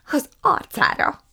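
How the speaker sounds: high, loud indignant hiss. A narrator's reading. Prue tells mama about the cabbage, hissing. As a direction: spit it out, high and cutting. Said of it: "sziszegte Prue".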